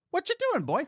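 squeaky high teasing call. Pretend. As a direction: tease him in a high squeak. Teasing the governor.

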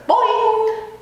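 high springy boing sound; frog's boing. Acted. Frog's high springy boing as he jumps. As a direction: bounce it out like a spring. Frog bouncing, making noise.

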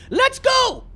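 high excited "let's go"; hyped. Real, not acted. Gamer starting a match, high hyped cheer. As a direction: kick it off with a burst of hype.

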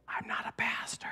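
hush, a sheepish denial; whispered protest. Retold or imitated. Whispering back to friends that he is not a pastor. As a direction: whisper back, half-laughing objection.

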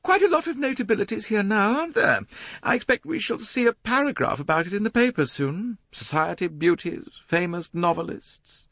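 a fussy society matron, preening; high complaining voice. A narrator's reading. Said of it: "in her high, complaining voice".

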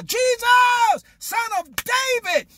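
high, drawn-out desperate call. Acted. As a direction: bellow it high over the crowd, stretching each word. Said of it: "shouted the louder".

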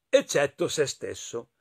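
usual speaking voice

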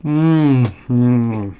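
chewing while humming; mouth full of food. Real, not acted. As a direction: cheeks stuffed with burger. Seen 3: Cheeks puffed, lips pursed, chewing slider bitten at -1.0.

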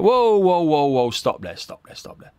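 high, loud call to stop; mock shout. Player stopping a session with a shout. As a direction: shout at the lads to halt play.

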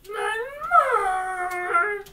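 very slow, high, loud groan of the name; real exasperated drawl; drawn-out dread. Real, not acted. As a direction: stretch the name out in dismay. Spotting hated Pokemon.

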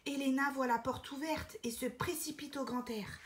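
usual speaking voice

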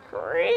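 imitated creaking door; soft, drawn-out creak. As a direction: creak slowly like an opening door. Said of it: "he heard the door creak open".

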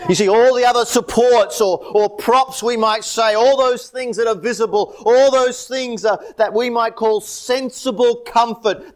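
high, insistent sermon voice; impassioned preaching. Real, not acted. Preacher on every visible support falling away, impassioned. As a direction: drive each phrase out with fervour.

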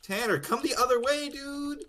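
high urgent call; being chased. Real, not acted. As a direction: call a teammate to flee. Urging teammate away.

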